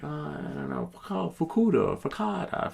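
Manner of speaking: high, groping at "focaccia"; fumbling pronunciation. Retold or imitated. Trying to order, fumbling "focaccia" up high. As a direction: stumble toward "focaccia" syllable by syllable. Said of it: "I was like".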